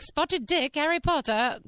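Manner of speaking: mock British, playfully exaggerated. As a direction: put on a cartoonish British accent. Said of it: "doing all the stereotypical".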